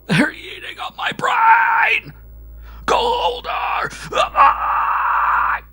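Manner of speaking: shrieking a name in terror, high and loud; hysterical wailing shouts. A narrator's reading. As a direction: wail it out, losing control. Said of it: "hysterical shoutings that merged into prolonged wails".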